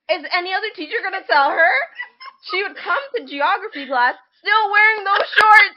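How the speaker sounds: high, loud, half-laughing; laughing disbelief. Real, not acted. Friend recalls teacher's shorts, laughing loud and high. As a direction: laugh through the outrage.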